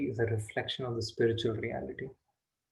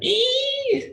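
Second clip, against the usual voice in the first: shrill high monkey screech ee; 6 dB louder than usual. Acted. Startled monkey spotting Yashoda, piercing screech.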